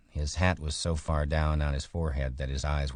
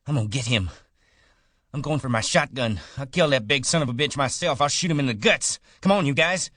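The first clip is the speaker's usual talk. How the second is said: loud, much higher, building furious shouts; fury; 11 semitones higher, 7 dB louder, faster than usual. A narrator's reading. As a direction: whip the men up into a lynch mob. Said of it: "worked himself into a fury".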